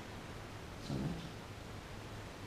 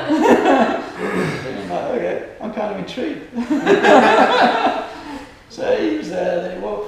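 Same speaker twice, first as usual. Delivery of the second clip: high, loud, through real laughter; amused words broken up by laughter; laughing; 12 semitones higher, 16 dB louder than usual. Real, not acted. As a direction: talk while cracking up. Cracking up at his own story.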